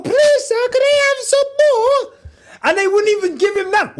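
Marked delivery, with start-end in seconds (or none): 0.0-2.1 high, pleading orphan voice; begging Oliver. Retold or imitated. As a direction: beg like a starving Victorian orphan. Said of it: "that Oliver was begging for".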